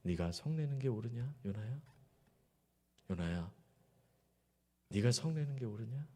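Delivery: soft, patient question to Jonah; God gently asking Jonah; gentle questioning. Acted. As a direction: ask him kindly, not scolding. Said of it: "인격적으로 질문하고 계세요".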